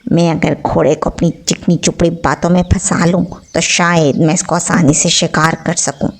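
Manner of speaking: wolf schemes to trap the horse with sweet talk, low sly voice; sly wolf thinking. A narrator's reading. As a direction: plot it in a low sly murmur. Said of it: "भेड़िए ने सोचा".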